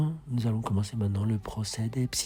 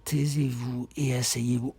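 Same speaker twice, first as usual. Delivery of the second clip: normal talking voice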